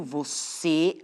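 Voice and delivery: "você" drawn out on CÊ; teacher sounding out "vo-CÊ"; stress on last syllable. Shown as a demonstration. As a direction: hold the final CÊ of "você". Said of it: "a sílaba mais forte é a última".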